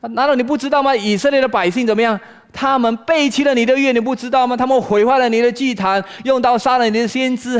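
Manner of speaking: high, loud accusing questions; impassioned, indignant protest; reproaching God. Acted. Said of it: "有点像在责问神".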